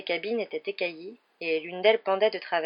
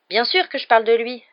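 loud, higher confirmation; emphatic exclamation; 5 semitones higher, 9 dB louder than usual. A narrator's reading. Ron confirms his suspect to Hermione, exclaiming. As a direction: exclaim that it is obvious. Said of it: "s'exclama Ron".

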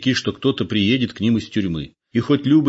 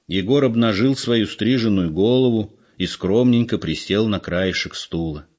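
ordinary delivery, nothing marked

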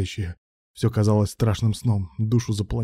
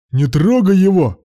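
high, loud drawn-out scream; 10 semitones higher, 9 dB louder, slower than usual. A narrator's reading. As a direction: scream it out slowly, desperate to stop them. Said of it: "завопил Руслан".

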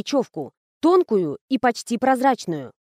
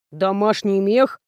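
slow, puzzled echo question; растерянно; slower than usual. A narrator's reading. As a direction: repeat it slowly, at a loss, rising at the end. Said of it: "растерянно повторил Николай". (растерянный переспрос, медленно)

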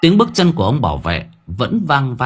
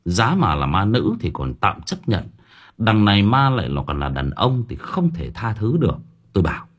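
ordinary delivery

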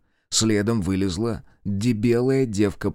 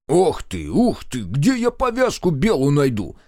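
grumbling; 5 semitones higher, 3 dB louder than usual. A narrator's reading. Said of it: "проворчал Шестаков".

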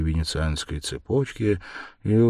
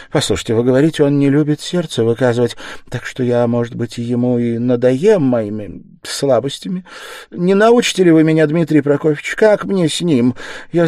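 put-on raised, pleading older woman's voice; helpless mother, higher and louder; lost, anxious mother; 9 semitones higher, 8 dB louder than usual. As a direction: beg for advice about her son. Mother asking for advice.

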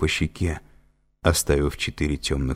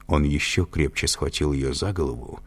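ordinary delivery, nothing marked

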